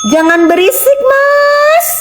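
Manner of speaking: a flirty falsetto cry; coy falsetto squeal; squeaky teasing call. Acted. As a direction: jump way up high and squeal it playfully.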